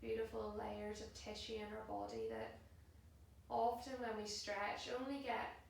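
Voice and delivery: soft, unhurried yoga relaxation voice; guided meditation. Acted. Yoga teacher settling class into a held pose, soft and calm. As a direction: guide them into stillness, hushed and spaced out.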